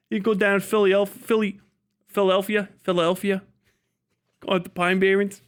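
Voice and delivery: slightly high, imitation Philly accent. Shown as a demonstration. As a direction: put on a half-sure Philly accent. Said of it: "not even quite Philly".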